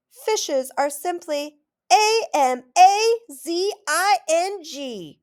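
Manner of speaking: slow, high, letter by letter; spelled-out AMAZING. A narrator's reading. Girl spells amazing to impress Stanley's dad, slow and high. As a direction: savor each letter of amazing.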